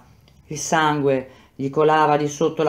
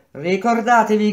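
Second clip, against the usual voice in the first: raised call across the site; 6 semitones higher, 4 dB louder than usual. Acted. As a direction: shout it to reach every corner. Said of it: "gridò Madì".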